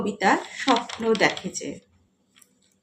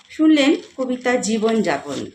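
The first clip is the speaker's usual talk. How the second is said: ordinary delivery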